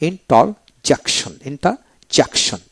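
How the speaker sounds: slow ইন-টার-জেক-শন with gaps; teacher's slow broken-up interjection; syllable by syllable. Shown as a demonstration. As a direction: read interjection in separate, measured parts. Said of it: "এইভাবে উচ্চারণ করবেন".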